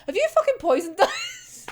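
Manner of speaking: mock-shouted accusation at a friendly offer; suspicious outburst. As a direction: blurt it like an alarmed accusation. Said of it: "and then just goes".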